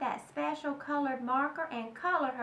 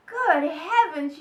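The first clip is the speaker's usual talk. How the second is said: loud, slow exclamation; mother cries out in shock; drawn-out loud gasp of words; yelled; 10 dB louder, slower than usual. Acted. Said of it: "yelled her mother".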